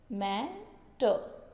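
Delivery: "मैटर" very slowly, मै, long pause, टर; split into syllables. Shown as a demonstration. Teacher repeating the trochee example "मैटर", slowly split in two. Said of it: "पहला stress syllable होता है".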